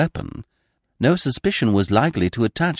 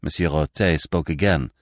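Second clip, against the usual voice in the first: ordinary delivery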